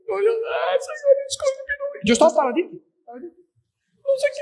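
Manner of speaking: high, wailing mock sob of the crashed driver; crying woman. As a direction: whimper it out like the upset lady. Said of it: "Ella salió llorando".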